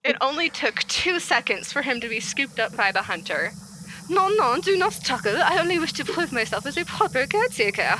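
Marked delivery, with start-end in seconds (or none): 4.1-8.0 higher-pitched, shaky attempt at French accent; amateur French accent for a story character; bad French accent. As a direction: put on a French accent, badly. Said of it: "Oh, it's so bad".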